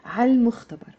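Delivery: Arabic: عالمختبر مع لام واضحة; L pronounced. Shown as a demonstration. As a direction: say عالمختبر with a clear L. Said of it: "We have to pronounce the L".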